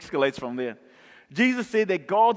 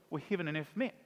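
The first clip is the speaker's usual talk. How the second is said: normal talking voice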